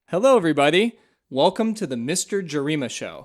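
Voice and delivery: big cheerful host hello; excited. Shown as a demonstration. Switches from radio voice to host.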